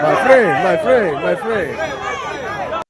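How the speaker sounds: loud, high hustler call, falling on friend; hustler imitation. Imitating a hustler calling out to tourists, sing-song. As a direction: lure them in like a street hawker.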